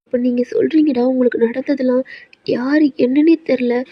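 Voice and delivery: ordinary delivery, nothing marked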